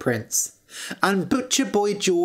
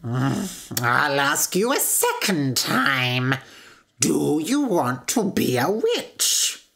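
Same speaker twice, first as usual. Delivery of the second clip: slow, drawn-out witch voice; sinister, deliberate storybook witch; menacing witch; slower than usual. A narrator's reading. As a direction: question slowly like a threatening witch. Witch asks a second time.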